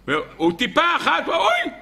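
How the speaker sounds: sudden high "oy" of burning pain; cry of pain. Retold or imitated. Rabbi Shimon crying out as the tear burns his wound, high yelp.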